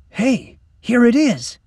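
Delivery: high, loud, drawn-out call; thrilled kid's exclamation; excited discovery. Acted. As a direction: call out the find to your friend. Finds the hidden trap door.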